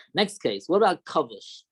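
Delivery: usual speaking voice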